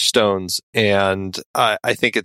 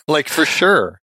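put-on slow, teasing Valley Girl drawl; hammed-up Valley Girl voice, laughing; Valley Girl speak; 5 semitones higher, slower than usual. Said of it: "like a Valley Girl".

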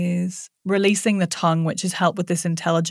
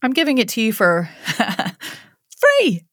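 playful reveal; 3 semitones higher than usual. Pretend. Playful joke about free.

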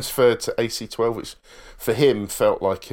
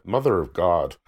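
put-on Irish accent; 2 semitones lower than usual. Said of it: "And he says".

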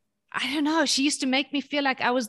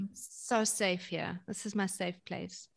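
put-on soft content child; 4 semitones lower, 10 dB quieter than usual. Voicing the child's feeling.

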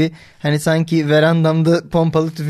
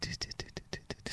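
soft, low, quick run of "tü"; imitated suspense jingle; 28 dB quieter, faster than usual. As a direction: tick out mock tension under your breath. Mimics quiet suspense jingle before verdict. (alçak sesle hızlı gerilim efekti taklidi)

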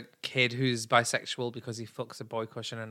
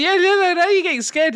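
excited teasing; 9 dB louder than usual. Real, not acted. Teasing partner.

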